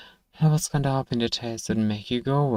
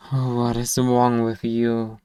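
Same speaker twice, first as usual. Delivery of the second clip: ordinary delivery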